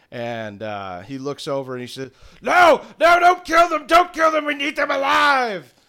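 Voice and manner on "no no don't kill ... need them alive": high, loud goblin general yelling; high-pitched panicked yell; frantic shouting. Acted. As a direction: shout a desperate order over chaos. Goblin general yelling orders while burning.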